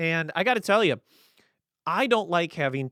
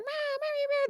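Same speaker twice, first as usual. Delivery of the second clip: cat meows. Retold or imitated. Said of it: "they went".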